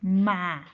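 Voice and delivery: "ma" said alone, strong and held; teacher isolating "ma" with force; stressed syllable. Shown as a demonstration. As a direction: punch "ma" as a long separate syllable. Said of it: "mayor fuerza de voz".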